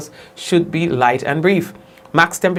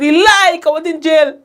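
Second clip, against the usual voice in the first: retold yell, much higher and louder; mock shout; 9 semitones higher, 5 dB louder than usual. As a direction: shout it like a passerby jeering in the street. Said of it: "he just shouted".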